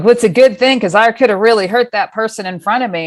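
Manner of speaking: imitating a slightly egotistical self; self-satisfied. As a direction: play up your own good deed. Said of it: "me being a bit on the, you know, egotistical side".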